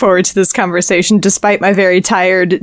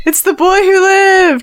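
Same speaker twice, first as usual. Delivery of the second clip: awestruck students; 9 semitones higher than usual. Retold or imitated. Students greeting Professor Potter.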